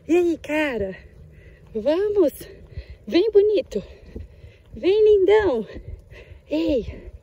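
loud, high calls to coax the dog over; high, loud pet-coaxing voice; calling the dog. Real, not acted.

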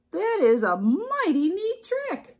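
slow, high, swooping dog voice; impressed dog. A narrator's reading. Dog admiring Duck's riding, impressed dog. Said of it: "what he thought was".

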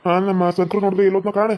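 normal talking voice

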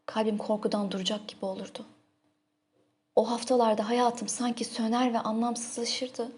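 usual speaking voice

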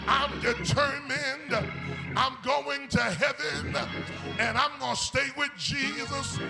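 usual speaking voice

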